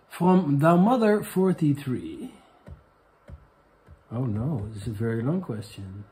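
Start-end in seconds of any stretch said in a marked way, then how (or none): 0.1-2.3 slow announcement. Acted. Announcing the viewer question.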